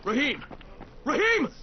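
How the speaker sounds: high anguished shout of the name; desperate call. Acted. Crane shouts for Rahim over the radio, desperate. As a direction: call out to him in alarm.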